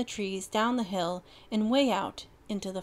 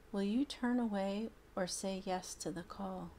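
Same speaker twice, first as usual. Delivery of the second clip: recited poem; 6 dB quieter than usual. Acted. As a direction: recite it gently like a mother. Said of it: "a poem his mother used to read".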